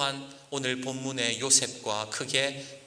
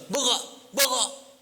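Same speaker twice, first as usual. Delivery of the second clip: angry shout; 10 semitones higher, much slower than usual. Retold or imitated. Tattooed cellmate forcing milk on the pastor, angry shout. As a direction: shove the order at him, furious. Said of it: "막 화를 내는 거예요".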